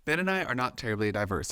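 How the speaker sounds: ordinary talk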